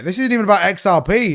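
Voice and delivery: ordinary talk, nothing marked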